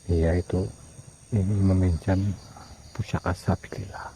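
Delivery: low, soft, halting murmur; quiet, secretive relaying of a message; hushed confiding. Real, not acted. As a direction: pass it on under your breath. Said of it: "ini bisik-bisik tetangga ini".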